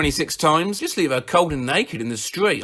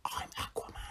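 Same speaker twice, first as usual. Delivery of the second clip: soft unvoiced whisper in the ear; 23 dB quieter, faster than usual. Sleeping boyfriend whispering to his partner, a hushed whisper. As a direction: breathe it into her ear, no voice. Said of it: "whispers in my ear".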